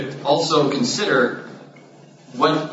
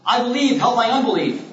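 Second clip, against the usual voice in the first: desperate appeal in a much higher voice; heart cry; 11 semitones higher than usual. Acted. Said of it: "this is the same heart cry".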